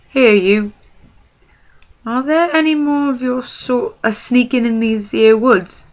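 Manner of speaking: high, loud rough troll interrogation; bullying troll. Acted. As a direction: loom over him and demand an answer.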